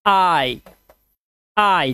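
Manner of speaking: "आई" as a drawn-out falling glide; pronunciation demo. Shown as a demonstration. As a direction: model the long vowel sound "आई". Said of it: "is equal to क्या हो गया".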